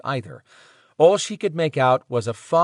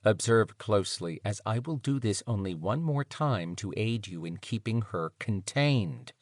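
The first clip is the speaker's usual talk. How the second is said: soft, smooth, measured voice; calm and silky, softer; 2 semitones lower, 7 dB quieter than usual. Acted. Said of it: "Mister Smooth said".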